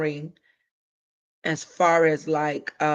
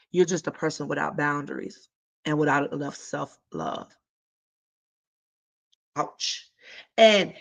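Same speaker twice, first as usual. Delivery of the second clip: ordinary talk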